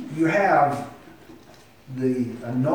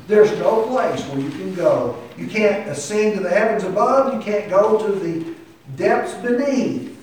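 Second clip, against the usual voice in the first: raised, emphatic pulpit delivery; forceful preaching; 6 semitones higher, 6 dB louder than usual. Real, not acted. Preacher warning congregation they can't hide from God, forceful. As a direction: press home that there is no escape.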